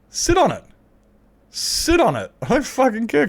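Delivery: high, loud, exaggerated indignation; exasperated retort. Streamer answering "you're an Australian, mate", high and indignant. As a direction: fire back in a high-pitched huff.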